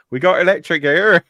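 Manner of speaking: hammed-up West Country yokel; West Country accent. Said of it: "referring to electric as opposed to electricity".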